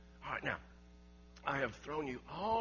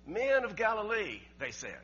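much louder and higher, hammering each phrase; preaching at full force; 8 semitones higher, 13 dB louder than usual. Acted. Preacher proclaiming the second coming to the congregation, fervent shout. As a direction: build the sermon to a shouting climax.

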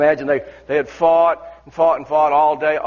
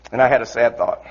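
ordinary delivery

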